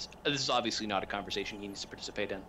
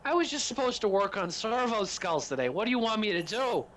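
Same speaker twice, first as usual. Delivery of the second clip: high, rattled excuses rising to a whine; a nervous worker talking fast and high about his mishap; frantic high-pitched excuse-making; flustered lumberjack; 7 semitones higher than usual. Acted. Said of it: "He goes".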